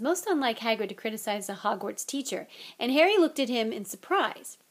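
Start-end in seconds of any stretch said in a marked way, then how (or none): none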